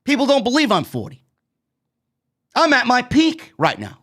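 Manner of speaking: ordinary delivery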